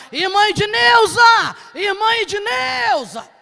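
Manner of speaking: high, loud, slow shout of her name; loud drawn-out shout. Retold or imitated. Man banging on the window to wake the sister, loud drawn-out shout.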